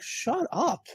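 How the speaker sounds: high, teasing "Shut up."; high, teasing brush-off of a compliment; playful shut up. Retold or imitated. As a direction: squeal it in fond disbelief. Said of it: "I'm like".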